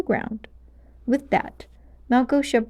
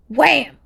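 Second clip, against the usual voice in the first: loud punchy "wham" smack; loud cartoon wham; mock impact sound; 6 semitones higher, 6 dB louder than usual. A narrator's reading. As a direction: bang it out like a comic panel. Fight scene sound effect.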